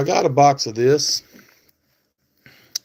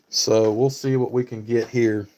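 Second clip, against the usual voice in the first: normal talking voice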